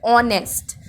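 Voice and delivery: "ऑनेस्ट" said alone, opening on the vowel, h silent; vowel-sound demo. Shown as a demonstration. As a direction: show the vowel that starts "ऑनेस्ट". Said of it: "इसके अंदर sound vowel की आ रही है". ("ऑनेस्ट" में शुरुआती स्वर की ध्वनि दिखाते हुए)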